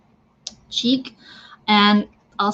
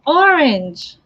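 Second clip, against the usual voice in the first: grand guest reveal; 5 semitones higher, 4 dB louder than usual. Pretend. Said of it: "And that special guest is".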